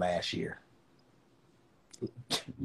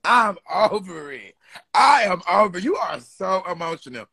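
dramatic exasperation; 6 semitones higher than usual. Real, not acted. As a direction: ham up being done with it. Dramatic teasing rant.

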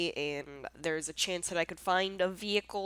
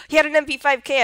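excited gasp; 8 semitones higher, 14 dB louder, faster than usual. Real, not acted. Excited after winning a firefight.

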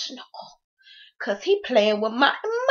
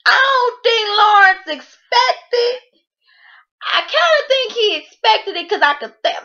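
crying laughing; 9 semitones higher than usual. Real, not acted. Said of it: "cry shout".

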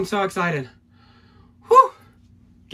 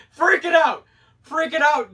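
excited; 10 semitones higher than usual. Real, not acted. Still thrilled after pull.

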